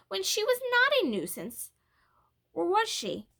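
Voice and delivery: hurt questioning. A narrator's reading. Ramona's hurt inner questioning.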